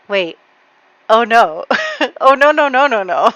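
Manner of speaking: loud, rising oh no panic; mock panic dawning; dismayed. Husband realizing his mistake, mock panic. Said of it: "is concerned now".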